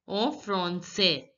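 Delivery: en français as "on fron-say", slow; silent final s. Shown as a demonstration. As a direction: drop the s at the end of français. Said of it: "the last letter S is not pronounced".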